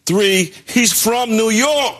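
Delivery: high, drawn-out mock New York accent. Basketball writer hamming up Mitchell's roots, bad New York accent. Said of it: "Is that a bad accent?".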